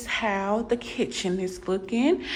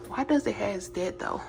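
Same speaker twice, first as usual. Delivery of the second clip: whispering; 7 dB quieter than usual. Real, not acted. Whispered aside in kitchen.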